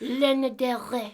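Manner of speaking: mock French accent with throaty R; giggly, put-on French pronunciation. Shown as a demonstration. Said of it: "say [name] in a French accent".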